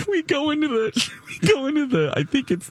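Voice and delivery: laughing through the words; squeaky laugh breaking the words. Real, not acted. Recounting the casino story: high laughing through the words.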